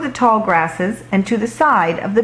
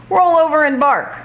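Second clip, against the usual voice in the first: high commanding owner voice, a light imitation; dog imitating his owner's command; bossy owner; 8 semitones higher, faster than usual. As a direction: play the bossy dog owner giving orders. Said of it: "You sound just like Bob".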